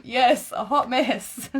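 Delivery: slow, loud, laughing through it. Real, not acted. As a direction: laugh as you repeat it. Laughing while repeating the phrase.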